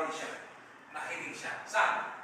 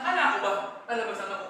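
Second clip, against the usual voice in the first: mock indignant; 3 semitones higher, 7 dB louder, much faster than usual. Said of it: "Naaman went away angry".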